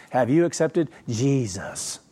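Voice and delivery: slow, put-on sugary preacher asking; syrupy evangelist voice. Preacher mocking how Protestants ask, syrupy voice. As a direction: draw it out like a fake-sweet street evangelist. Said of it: "That's how they say it".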